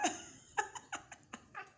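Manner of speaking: high breathy falling giggle; amused fading giggle; real giggle. Real, not acted. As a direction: let the giggle spill out. Laughs at her own stumble.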